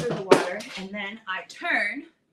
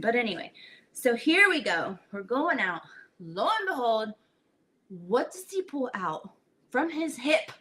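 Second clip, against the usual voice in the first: usual speaking voice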